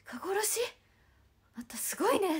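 retelling the makeup artist's loud amazed reaction; amazed exclamation. Retold or imitated. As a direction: blurt it out stunned by the news.

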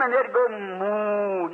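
a long, slow, low moo like wind through pines; mournful wind moan. Retold or imitated. Preacher imitating wind moaning through pines, slow mournful moo. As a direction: draw the moan out slow like wind in dead trees. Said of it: "a mournful sound".

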